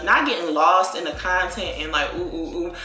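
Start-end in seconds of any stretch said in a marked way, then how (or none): none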